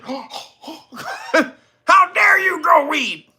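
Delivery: high, laughing mock gasp of horror; mock scandalised. Vlogger mocking the weed charge, high and laughing.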